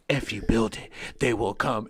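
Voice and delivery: low, hushed movie-quote voice; movie ghost. Acted. Retelling Field of Dreams ghost scene, low solemn quote. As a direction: drop low for the solemn prophecy. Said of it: "and he goes".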